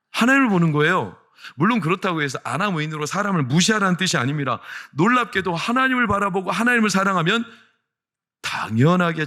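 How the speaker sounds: high, loud, emphatic sermon delivery; forceful preaching. Real, not acted. Pastor urging leaders to look to God, forceful preaching.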